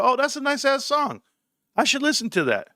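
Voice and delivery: high-pitched pleased reaction; mock impressed, high voice; acted delight. Acts out his delight at songs.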